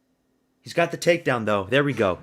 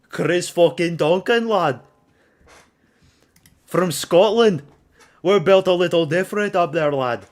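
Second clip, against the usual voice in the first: loud, hammed-up Scottish accent; amateur Scottish accent, raised and celebratory; attempted Scottish accent; 4 semitones higher, 7 dB louder than usual. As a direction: crow proudly in a shaky put-on Scottish accent. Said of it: "I don't know Scot-, Scottish accent".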